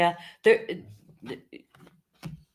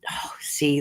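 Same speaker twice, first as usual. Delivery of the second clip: ordinary talk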